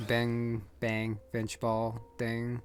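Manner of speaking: ordinary talk, nothing marked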